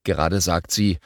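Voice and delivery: ordinary delivery, nothing marked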